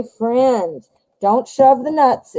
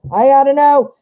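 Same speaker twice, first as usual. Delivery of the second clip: higher and louder, bursting with suspense; excited outburst; 5 semitones higher, 6 dB louder than usual. Real, not acted. Reader dying to see the outcome card, excited. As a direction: blurt it out, you can't wait another second. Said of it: "Ooh, I'm dying".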